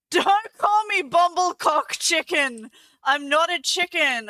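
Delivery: loudly offended, voice jumping up; mock outrage. Real, not acted. Streamer protesting chat's insulting bird nickname, indignant.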